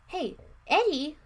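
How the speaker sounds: high, loud, slow drawn-out shout. A narrator's reading. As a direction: shout for a missing brother. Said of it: "I shouted".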